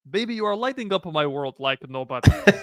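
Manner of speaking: put-on song lyric in a thick Russian accent. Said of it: "funniest in a Russian accent".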